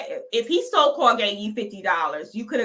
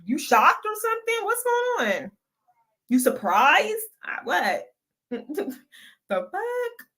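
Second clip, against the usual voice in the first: high teasing surprise; 9 semitones higher than usual. Real, not acted.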